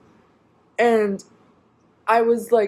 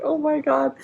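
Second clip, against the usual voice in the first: high, crying "Oh my God"; half-sobbing it out; crying in the lobby, high and shaky; tearful cry; 7 semitones higher than usual. Real, not acted. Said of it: "crying in this wheelchair".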